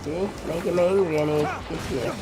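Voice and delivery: ordinary delivery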